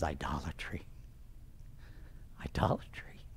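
very soft, low near-whisper; tearful realisation, retold. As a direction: imitate stricken disbelief, the word repeated under the breath. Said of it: "tears are now rolling down his face".

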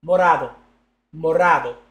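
Morado said Spanish-style, loud and falling. Shown as a demonstration. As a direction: call out Morado like a prayer. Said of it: "Next pack".

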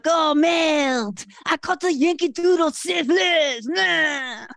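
hammed-up cartoon foreigner lamenting; exaggerated comic wail in a put-on voice; mock foreign complainer. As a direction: play a sick traveler griping theatrically. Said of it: "and be all like".

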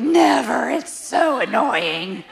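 high, loud 'never', then drawn-out complaint; playfully fed up, stretching out the gripe; mock exasperation. Wife mock-complains about calm husband.

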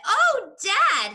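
very high, loud exclamation; excited cry. A narrator's reading. Smallest fox leaping, calling to Dad, crying out. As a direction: burst out like a thrilled little fox. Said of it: "he cried out".

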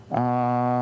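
put-on a long held ah modeled for a child. Shown as a demonstration. Therapist modeling a long ah for a deaf child. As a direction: sing out a long open ah for her to copy. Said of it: "Can you tell me a nice long sound".

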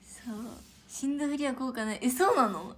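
ordinary talk

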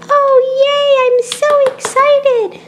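high, loud squeal of joy; thrilled squeal. Acted. Child hearing she may get a pet, squealing with delight. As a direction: squeal like a kid just promised a pet.

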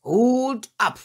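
loud, high command; urgent master's call. A narrator's reading. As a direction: shout a quick order to the dog. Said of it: "ruft schnell Herr Barthel".

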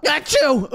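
high acted achoo; mock sneeze. Robot's laser goes off by accident, high fake sneeze. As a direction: burst out a pretend sneeze. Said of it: "Dude, stop sneezing".

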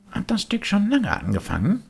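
put-on high, longing puppet boy; a boy pulled toward the music; wistful high boyish voice; Bengele's voice. Bengele asks.

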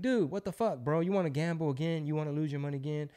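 high-pitched, exasperated scolding without raising volume; roasting his uncle. Retold or imitated. Nephew telling off his uncle over gambling, mock exasperation. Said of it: "roasting his uncle about some shit".

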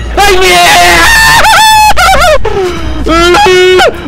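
long, loud, high shriek; real drawn-out jump-scare scream; terrified scream. Real, not acted. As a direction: scream at full force in fright.

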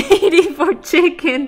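loud disbelief bursting into laughter; incredulous laughing. Real, not acted. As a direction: crack up at the absurd bargain. Absurd trade of Alice for chicken.

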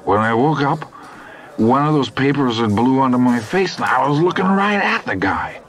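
high, animated telling; excited Hap. A narrator's reading. Hap telling Bozeman what he saw, high and animated. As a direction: spill the story eagerly. Hap tells his story.

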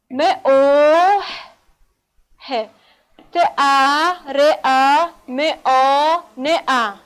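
م او ه ت ا ر ا م آ ن ا, each sound slow and apart. Shown as a demonstration. Teacher sounding out محترمانه letter by letter.

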